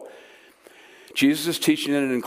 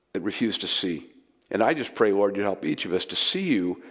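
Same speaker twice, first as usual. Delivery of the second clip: prayer; 4 semitones lower than usual. Acted. Pastor opens the sermon in prayer.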